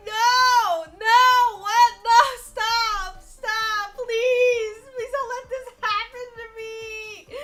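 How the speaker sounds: high, loud desperate begging, cracking; retelling a toilet panic, pleading high and loud; panicked pleading. Retold or imitated. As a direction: beg the toilet not to clog, voice breaking. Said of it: "And I was like".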